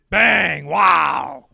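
loud, triumphant bang call; announcer's big call. Pretend. Fan imitating the TV announcer, shouted big call. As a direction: shout it like a TV play-by-play man.

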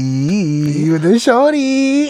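very slow, high singsong tease; teasing drawl. Pretend. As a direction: stretch it out to rib him. Teasing friend about a girl.

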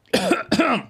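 wet, choking cough; coughing up water. Real, not acted. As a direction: cough out the water caught in the throat. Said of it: "I got water in my throat".

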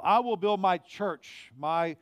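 full-voiced sermon proclamation; preaching proclamation. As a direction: preach it out to the whole room.

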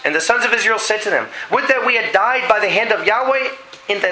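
reading the complaint of Israel aloud; scripture reading. Acted. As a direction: proclaim the verses to the congregation.

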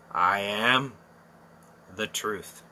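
the Lord's words, hushed and low; quiet solemn. Acted. Preacher quoting Jesus softly to the congregation, hushed. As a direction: let it fall quietly, reverent.

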